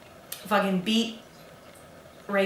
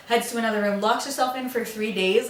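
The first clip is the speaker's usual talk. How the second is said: ordinary delivery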